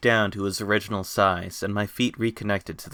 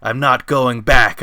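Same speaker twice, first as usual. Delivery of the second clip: slow, much louder roar of defiance; 3 semitones higher, 10 dB louder than usual. A narrator's reading. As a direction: roar it at your enemy. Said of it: "I roared".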